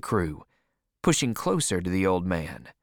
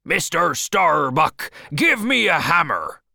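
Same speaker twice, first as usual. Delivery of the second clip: shouting; 7 semitones higher, 6 dB louder than usual. Acted. Captain to first mate, demanding a hammer, shouted. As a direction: bellow the order to your mate. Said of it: "He shouted to the first mate".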